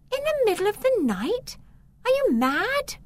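as if he's lost his mind; girl told to flee at night, incredulous. Acted.

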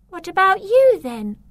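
sudden fear for him; girl suddenly worried for the boy; worried question. Acted.

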